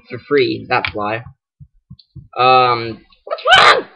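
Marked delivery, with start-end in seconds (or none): 3.2-3.9 very high squeaky put-on voice; wrong character voice. Gamer voicing game dialogue, silly high squeaky voice. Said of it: "Sorry, wrong voice".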